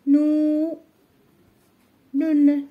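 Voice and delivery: سا then نو then نون, each syllable called out separately; sounding out letters. Shown as a demonstration. Teacher sounding out letters of سنون while writing. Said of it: "غنكتبوا سا،".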